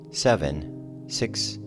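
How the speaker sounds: ordinary delivery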